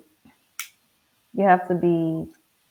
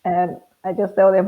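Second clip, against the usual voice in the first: usual speaking voice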